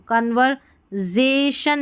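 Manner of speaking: "कन्वर्सेशन" with z for s, con-ver-zay-shun; wrong pronunciation. Shown as a demonstration. Teacher shows the mistake, "कन्वर्सेशन" with a z. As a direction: put a buzzing z in "कन्वर्सेशन". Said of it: "कन्वर्सेशन नहीं पढ़ेंगे".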